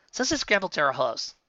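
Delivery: plain reading voice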